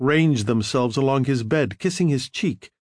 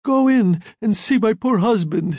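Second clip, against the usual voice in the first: higher, eager babbling widow; 9 semitones higher, 3 dB louder than usual. A narrator's reading. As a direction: pour it out in a rush. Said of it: "She babbled on".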